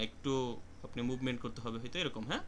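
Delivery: usual speaking voice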